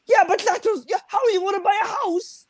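put-on very high whiny Cork accent; mock Cork accent. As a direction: wail about house prices in a Cork accent. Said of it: "they were like".

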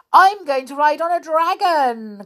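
put-on high, loud eager child; excited child. A narrator's reading. Child at the fair calling out, high and eager. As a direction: squeal it out with delight. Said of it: "cried one".

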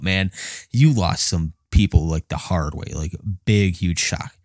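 usual speaking voice

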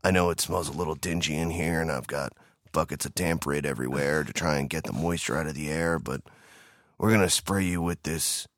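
low, ominous creep voice; ominous creepy voice. Retold or imitated. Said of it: "Do you know that voice scares people".

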